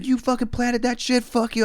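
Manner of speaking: high-pitched mock outrage; tripping out. Bandmates accusing officers while everybody looks on, tripping out. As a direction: imitate panicked, furious accusing without extra volume. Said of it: "like, fucking tripping".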